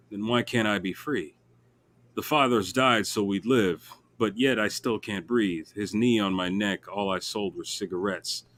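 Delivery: slow spoken-word poem; slow poem reading. Acted. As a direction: recite it slowly, letting lines land. Reads his poem aloud.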